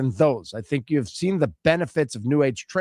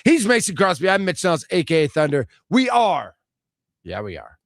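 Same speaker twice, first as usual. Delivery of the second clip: show sign-off. Acted. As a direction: hype up the show name.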